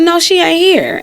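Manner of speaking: put-on a touch of teen sass; bad attitude. Young girl telling the man her mom isn't home, mock curt. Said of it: "I had a bad attitude".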